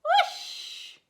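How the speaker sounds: high breathy whoosh. Acted. Goose dancing on the breeze, high breathy whoosh. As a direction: blow it like a gust of wind.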